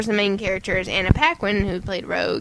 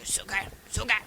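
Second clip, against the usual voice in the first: put-on softer clipped-name old English accent; 9 dB quieter, faster than usual. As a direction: imitate his old English accent lightly. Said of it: "English accent".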